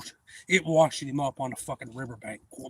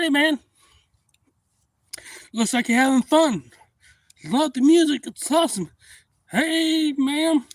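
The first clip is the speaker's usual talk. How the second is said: high, loud friendly hail across the water; cheery call-out; 13 semitones higher, 9 dB louder, slower than usual. Retold or imitated. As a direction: greet them brightly from the boat. Friendly greeting to swimmer.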